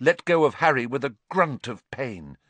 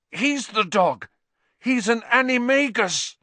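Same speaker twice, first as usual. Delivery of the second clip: high, strained warning; urgent warning; 12 semitones higher, 4 dB louder than usual. Acted. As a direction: warn them through pain. Ron warns in pain.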